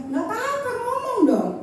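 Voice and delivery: high, lilting encouragement, acted; easygoing reassurance. Husband inviting her to speak up.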